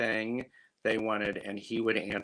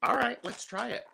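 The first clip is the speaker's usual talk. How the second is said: mock inner voice; 12 semitones higher, faster than usual. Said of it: "I'm just, like, going".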